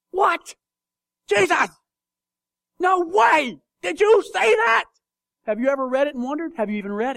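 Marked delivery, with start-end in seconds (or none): 0.1-4.8 much higher, louder shocked cry; shocked disbelief. Shown as a demonstration. Preacher showing his reaction to Jesus, shocked and loud. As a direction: cry out in mock outrage. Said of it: "you know what I'm doing?".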